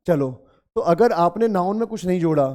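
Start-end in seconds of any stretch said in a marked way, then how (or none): none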